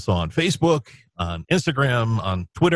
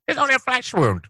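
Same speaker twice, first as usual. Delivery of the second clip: much higher hammy knight voice. Pretend. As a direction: shout it like a proud knight. Monty Python quote repeated.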